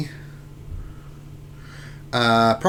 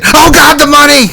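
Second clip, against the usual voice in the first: high, loud cry over the lost money; mock-panic catchphrase yell; panicked yell; 16 dB louder than usual. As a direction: shriek it like everything is collapsing.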